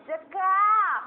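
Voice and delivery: জাকা chewed out loud and high; chewed-up word. Shown as a demonstration. Madam চিবিয়ে চিবিয়ে জাকা বলার ভঙ্গি. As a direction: chew the word জাকা hard. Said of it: "চিবায় চিবায় বলে না".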